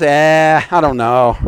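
half-hearted shrug in the voice, sinking low; mock unconvinced. Preacher mimicking unconvinced saints answering Jesus, half-hearted. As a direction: imitate a doubter who can't be bothered. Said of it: "you don't sound too convinced".

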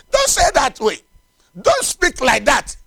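high, forceful sermon rebuke; rebuking preacher. Real, not acted. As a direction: call it out sternly from the pulpit.

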